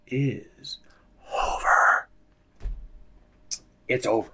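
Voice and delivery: hushed dramatic whisper. Acted. Gamer whispers that the series is over, dramatically. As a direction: breathe over like a final verdict.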